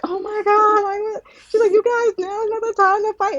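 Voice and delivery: startled actress. Retold or imitated. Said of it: "And she's like".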